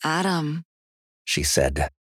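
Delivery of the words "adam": high, controlled voice of another being; clearer possessed voice. A narrator's reading. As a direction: say the name clearly, as someone else speaking through her. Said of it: "much more clearly".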